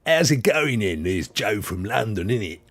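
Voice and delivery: hammed-up London geezer accent; comic Londoner accent, laughing underneath; mock London accent. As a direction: put on a broad London accent. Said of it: "you also be more like".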